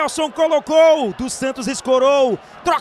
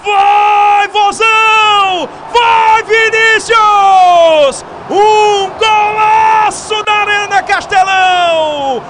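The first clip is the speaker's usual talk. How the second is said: roaring stretched goal call; goal shout; 5 semitones higher, 10 dB louder, slower than usual. Real, not acted. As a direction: scream the goal to the stands.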